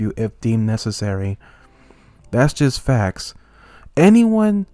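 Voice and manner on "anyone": high, loud angry burst; sudden angry outburst. Acted. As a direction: explode on the word. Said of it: "I, I'm getting angry".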